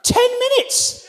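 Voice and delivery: high, drawn-out, loud cry; slow, high shout of comic outrage; outraged shout. Acted. Guest telling of bathroom wait, outraged shout.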